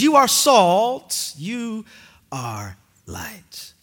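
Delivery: tender blessing. Acted. Tender blessing fading to hush.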